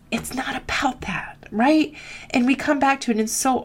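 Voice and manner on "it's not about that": a short hushed aside; whisper. Real, not acted. Coach telling students it's not about that, whispered. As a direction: drop to a whisper for the point. Stressing point to viewers.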